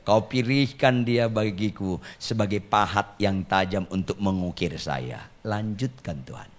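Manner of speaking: low, soft murmur to God, sinking at the end; mock-meek prayer. Husband telling God about his angry wife, meekly hushed. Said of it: "tetap saya bilang sama Tuhan".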